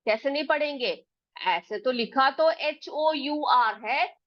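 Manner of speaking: ordinary talk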